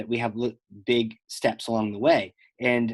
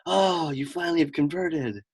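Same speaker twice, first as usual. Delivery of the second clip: slow, higher, drawn-out mock delight; delighted husband; 4 semitones higher, slower than usual. Host voices guest's husband at her conversion, delighted. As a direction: play the husband, pleased and savouring it slowly. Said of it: "your husband will be like".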